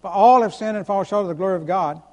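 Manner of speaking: usual speaking voice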